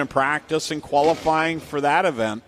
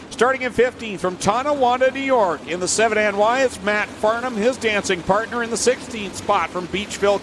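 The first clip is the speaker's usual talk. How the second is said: announcer; 5 semitones higher than usual. Real, not acted. Reads the starting lineup.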